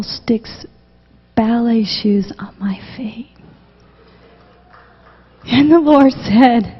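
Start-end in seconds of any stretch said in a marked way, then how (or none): 0.0-3.3 hushed whisper, trailing away; whispered, much softer; awed whisper. Real, not acted. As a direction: breathe it out in wonder. Awed retelling of the vision.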